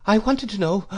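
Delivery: put-on high, timid boy's voice; nervous, high-pitched request; trembling. A narrator's reading. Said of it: "I said trembling".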